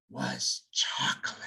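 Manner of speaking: whispered like a precious secret; dramatic whisper. A narrator's reading. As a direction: breathe the word out in a hushed whisper. Dramatic reveal of longed-for treat.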